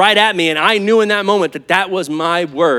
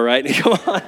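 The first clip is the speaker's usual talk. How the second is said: ordinary talk, nothing marked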